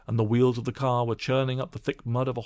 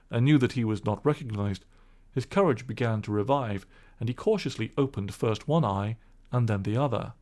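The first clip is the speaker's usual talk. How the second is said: ordinary delivery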